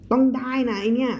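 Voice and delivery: ordinary delivery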